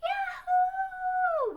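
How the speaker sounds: very high, loud whoop of delight; joyful yell. Acted. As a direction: cheer it out at the top of your voice. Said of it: "we yelled".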